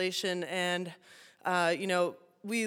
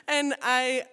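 ordinary delivery

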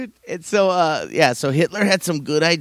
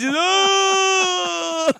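put-on kids crying out.

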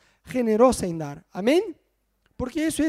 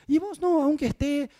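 higher, mock-sweet wife voice; imitating his wife; 8 semitones higher than usual. Preacher quoting his wife's praise, higher mock wife voice. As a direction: lightly play the doting wife insisting. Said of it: "Muchas veces Ana me dice".

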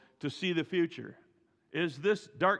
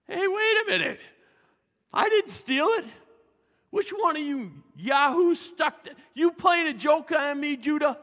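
much higher, loud, shouting protest; panicked youngest brother; 11 semitones higher, 6 dB louder than usual. Acted. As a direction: yell your innocence in a panic. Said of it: "what the young guy's thinking".

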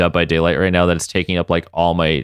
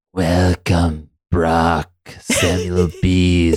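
Caliban voice; 2 semitones lower, much slower than usual. Pretend. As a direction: creep out each name slowly, like a haunted house host. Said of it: "Maybe you wanna do that Caliban voice again?".

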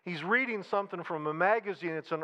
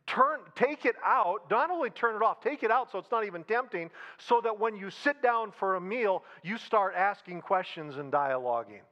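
ordinary talk

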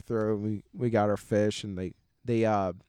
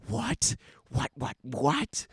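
shocked disbelief; 3 semitones higher, much slower than usual. Retold or imitated. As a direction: play the stunned teenage cousin. Shocked cousin reacting to drinking.

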